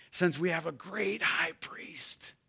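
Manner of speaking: hushed, breathy aside; whisper. Acted. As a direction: lean in and whisper it.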